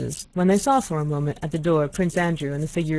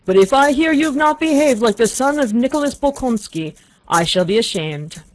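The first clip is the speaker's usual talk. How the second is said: put-on high, loud querulous old man's shriek; sudden high querulous outburst; 8 semitones higher, 8 dB louder than usual. A narrator's reading. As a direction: shriek it out like a fretful old father. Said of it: "in a querulous voice, suddenly shrieked".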